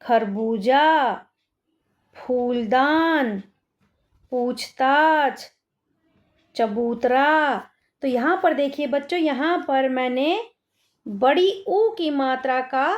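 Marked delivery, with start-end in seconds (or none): 0.0-7.5 slow word dictation. Shown as a demonstration. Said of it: "आगे देखते हैं".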